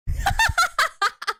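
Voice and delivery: very high, loud laughter; big squealing laugh; real laughing fit. Real, not acted. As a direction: burst out laughing at your own flirting. Laughs after joke flirting with viewer.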